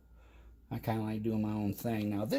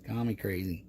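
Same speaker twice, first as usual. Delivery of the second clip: usual speaking voice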